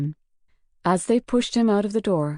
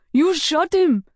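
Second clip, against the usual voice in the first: high, loud horrified shout; horrified shout at the killer; 10 semitones higher, 5 dB louder, slower than usual. Acted. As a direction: scream it in shock. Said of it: "he shouted".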